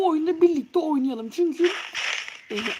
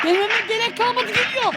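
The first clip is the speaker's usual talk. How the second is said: 1 alarmed rising warning; 7 semitones higher than usual. Real, not acted. Monster arrives in game.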